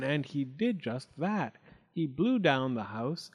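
usual speaking voice

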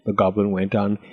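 ordinary delivery, nothing marked

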